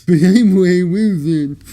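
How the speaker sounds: strained squeaky alien voice; weird put-on voice. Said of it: "why is your voice doing that?".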